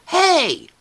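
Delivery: high and loud, playfully wounded; grandma calling to the boy who ran past; mock hurt plea. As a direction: call after him, pretending to be hurt. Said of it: "And then I'll go".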